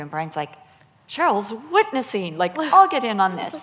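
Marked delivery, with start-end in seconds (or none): 1.1-3.6 loud, higher, swooping excitement; imitated eager realization. Imitating husband joining her witnessing, eager realization. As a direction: mimic someone jumping in eagerly.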